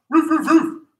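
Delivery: high, loud playful barks; dog barking. Pretend. Coach barks like a dog during downward dog, playful. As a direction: bark like a puppy. Upward dog bark imitation.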